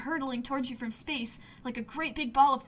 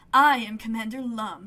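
proud; 2 semitones lower, 7 dB louder than usual. Acted. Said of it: "he says proudly".

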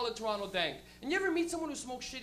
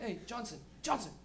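cop talking to partner; 2 semitones lower, 9 dB quieter than usual. Retold or imitated. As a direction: quietly point it out to your partner. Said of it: "they just look at a car".

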